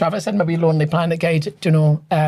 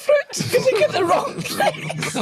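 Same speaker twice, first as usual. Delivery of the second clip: high, squealing laugh through the words; helpless laughter; slower than usual. Real, not acted. Storyteller reaches the fruit punchline, cracking up laughing. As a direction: barely get it out for laughing.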